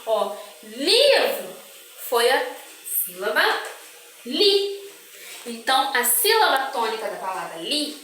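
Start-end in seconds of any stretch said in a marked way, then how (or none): 0.6-1.6 slow "livro" with a long, forceful LI; "livro" drawn out on its stressed first syllable. Shown as a demonstration. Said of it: "li foi a sílaba que eu falei com mais força". 4.2-4.8 "li" said alone, high and firm; stressed syllable. Shown as a demonstration. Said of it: "falei com mais força".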